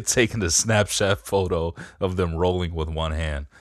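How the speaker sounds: ordinary talk, nothing marked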